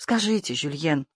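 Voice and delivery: soft, falling plea; quiet entreaty; pleading. A narrator's reading. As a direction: beg him gently to answer. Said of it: "молила она".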